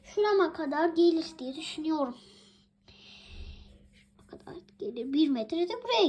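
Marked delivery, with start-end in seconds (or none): 3.9-5.1 soft, fast whispered aside. Pretend. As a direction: whisper it quickly, secret-like. Repeats the phrase as a whisper.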